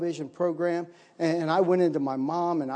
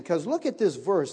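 normal talking voice

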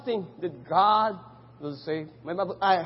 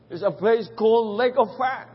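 usual speaking voice

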